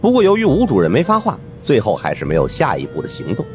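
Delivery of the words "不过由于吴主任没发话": plain reading voice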